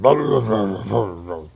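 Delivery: loud then fading gibberish mumble; weird mumbling. Acted. Bork speaks up again, weird mumbling. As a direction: garble it and trail off. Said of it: "really weird mumbling".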